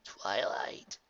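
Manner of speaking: soft whispered name; just breathe her name. A narrator's reading. Spike whispering to Twilight.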